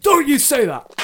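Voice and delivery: a knight shouts down his squire in mock outrage. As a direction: snap back in mock fury.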